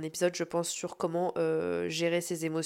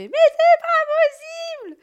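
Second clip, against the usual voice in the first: very high, very loud scream of fury; full-throated screamed frustration; furious scream; 10 dB louder, much faster than usual. Retold or imitated. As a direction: explode at the soaked parquet. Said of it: "Et là, je fais".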